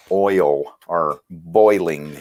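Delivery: oil or boiling, each word drawn out very slowly; careful oil. Shown as a demonstration. Contrasting words for the hosts, very slow and deliberate. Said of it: "with my pronunciation 'cause I say".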